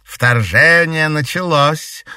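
slow, smiling menace; smiling villain. A narrator's reading. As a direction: savor it with a smile. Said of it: "с улыбкой заметила дама теней".

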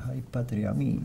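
soft, sighing last words; dying words. Retold or imitated. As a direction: breathe it out like a dying man. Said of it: "Recuerdo a Belgrano sus últimas palabras".

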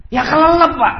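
high, loud blurted answer; acted exasperated cry; exclaimed retort. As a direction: burst out with the obvious.

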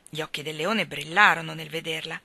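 ordinary delivery

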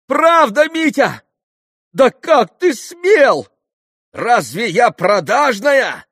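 very high, loud outraged shouting; hysterical. A narrator's reading. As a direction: demand an answer, screaming each question. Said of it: "истерически вскричала Грушенька". (истерический, оскорблённый крик)

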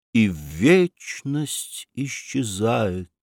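slow, measured recital of a quatrain; reading verse aloud. A narrator's reading. Alexei reads a verse aloud at home, slow and solemn. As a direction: savour each line like poetry. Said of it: "он вслух прочитал".

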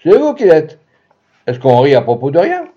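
high, loud indignant reply; a touch of outrage at the laughing crowd; angry retort. A narrator's reading. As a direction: snap back at the croupier. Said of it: "Je répliquai".

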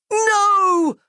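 very high, loud wail of despair; devastated cry. A narrator's reading. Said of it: "Roz cried, the desperation".

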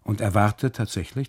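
plain reading voice

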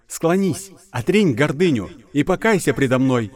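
loud, high divine wrath; booming goddess voice. Acted. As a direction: thunder it down as an angry goddess. (голос разгневанной богини)

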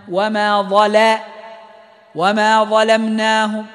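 وما ظلمناهم chanted, lam kept thin after the heavy za; light lam. Shown as a demonstration. Teacher reciting وما ظلمناهم for students, slow chant. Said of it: "فنحرص على ترقيق اللام".

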